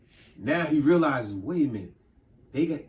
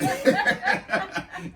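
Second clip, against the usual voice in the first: high burst of laughter. Real, not acted. Laughs after the joke.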